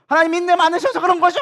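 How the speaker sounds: high, loud sarcastic jab; Jonah. Acted. Jonah mocking God's patience, sarcastic and heated. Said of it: "비꼬는 거예요".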